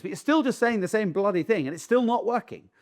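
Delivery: ordinary talk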